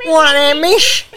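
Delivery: put-on very high cheeky teen voice; mocking teen impression. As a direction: taunt like a cocky schoolkid. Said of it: "do a voice of a teenager".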